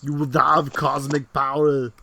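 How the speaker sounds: put-on slow, loud Austrian-accented Schwarzenegger voice; Schwarzenegger impression. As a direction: boom it out like Arnie with an Austrian accent. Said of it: "You sound like Arnold Schwarzenegger there".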